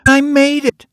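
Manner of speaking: put-on high, loud child's protest; a boy insisting he made it; protesting little boy. A narrator's reading.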